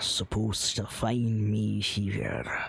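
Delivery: put-on the old dwarf, turning wary, last words whispered; old dwarf whispering that only his friend may find him. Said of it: "do the voice".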